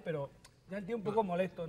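ordinary talk, nothing marked

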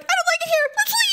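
high-pitched mock plea to go; Olivia whining. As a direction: put on a small, high, complaining voice. Voices Olivia wanting to leave.

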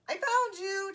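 high, loud, gleeful call; chirped. A narrator's reading. Bird finding hidden Bear, chirping. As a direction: chirp it out triumphantly. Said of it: "chirped Little Bear".

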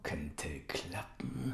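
slow unvoiced whisper. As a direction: breathe it slowly. Uncle whispers.

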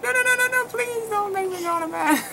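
high-pitched mock panicked plea; pleading. Patient begging midwife not to make her get on the bed, pleading. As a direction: beg in a squealing, hammed-up voice.

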